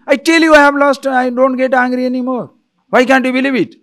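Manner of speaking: loud, high, indignant outburst; hammed-up exasperated insistence on being calm; losing temper. Person denying anger to a doubter, heatedly.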